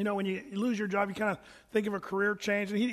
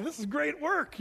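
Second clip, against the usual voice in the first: higher-pitched delighted quote; excited; 6 semitones higher than usual. Retold or imitated. Man in gorilla suit enjoying his new job, excited. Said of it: "He was excited".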